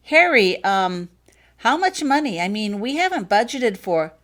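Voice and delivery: father fretting about school fees; anxious blurt. Acted. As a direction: worry spilling out about the money. Worried father asks cost.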